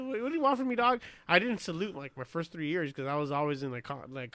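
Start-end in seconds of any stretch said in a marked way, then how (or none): 0.0-1.0 high-pitched retold plea; exasperated protest. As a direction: protest helplessly, voice jumping up.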